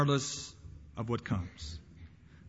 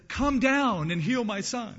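raised, drawn-out plea of a desperate father; 8 semitones higher, 4 dB louder, slower than usual. Retold or imitated. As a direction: beg urgently, pitched high and stretched out. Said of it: "hear the desperation in his voice".

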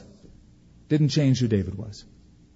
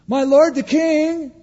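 much higher, loud slow call across the distance; 13 semitones higher, 5 dB louder, slower than usual. Acted. David calling after Saul from the cave. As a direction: cry out reverently to the king.

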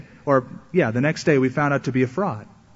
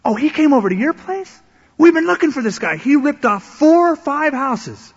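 much higher, excited warning voice; alarmed ministry worker; 11 semitones higher, 7 dB louder than usual. Retold or imitated. Ministry worker on the phone warning about the thief, excited. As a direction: blurt it out like a startled receptionist. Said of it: "this is what they said".

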